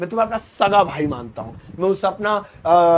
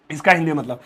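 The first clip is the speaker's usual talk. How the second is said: normal talking voice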